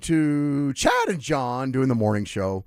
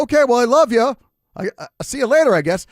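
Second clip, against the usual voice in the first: resigned goodbye; 12 semitones higher, 10 dB louder than usual. Retold or imitated. Grandson leaving defeated.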